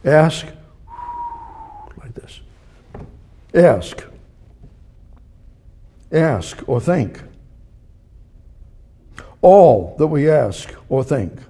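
deliberate preacher's build; building phrase by phrase. Shown as a demonstration. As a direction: stack each phrase and lean into it. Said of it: "I'm gonna start at the top".

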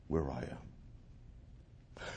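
slow breathy whisper, almost gone. Acted. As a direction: let the words fade into a whisper.

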